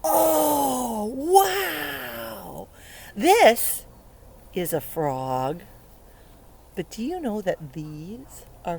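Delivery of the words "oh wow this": delighted wow. Real, not acted. Surprise at big frog.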